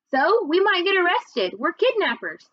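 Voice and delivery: high-pitched, worried little brother; mildly panicked child's argument; alarmed protest. A narrator's reading. Brother alarmed about being arrested.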